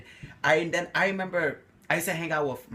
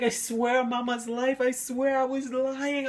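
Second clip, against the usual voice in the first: higher, desperate pleading swear; pleading oath; 11 semitones higher, slower than usual. Retold or imitated. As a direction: beg her to believe you, voice pitched up. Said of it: "And I was like".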